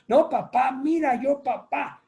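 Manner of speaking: hijo que suplica, voz más aguda; hijo negociando. Acted. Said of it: "Son muy buenos para negociar". (hijo regateando con papá)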